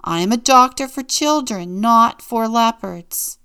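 high, very loud indignant declaration; loud announcement. A narrator's reading. Pediatrician refuses to treat a leopard, loud emphatic announcement. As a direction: declare it firmly, slowing at the end. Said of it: "he announced".

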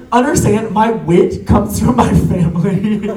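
ordinary talk

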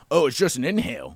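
quick, loud, brushing it off; fast and loud, dismissive. Retold or imitated. Retelling the buddy's reply.

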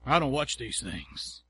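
low, slow self-satisfied boast; smug, pious brag. Retold or imitated. A preacher mocking his younger self, slow smug brag. Bragging holy quote, mocked.

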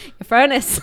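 loud mock Irish accent on "furnace". Mocking her own furnace reflex, loud Irish accent. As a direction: blurt it in a put-on Irish accent. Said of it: "without doing an Irish accent".

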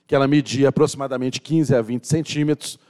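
normal talking voice

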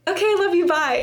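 bright, high, loud "love you, bye"; sing-song goodbye. Retold or imitated. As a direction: chirp it like ending a call with a friend. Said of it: "then be like".

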